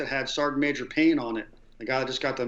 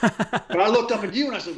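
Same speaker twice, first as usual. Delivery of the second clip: laughing; 6 semitones higher than usual. Real, not acted. Laughing while retelling.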